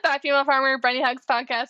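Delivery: usual speaking voice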